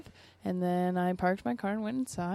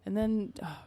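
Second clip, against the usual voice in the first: ordinary delivery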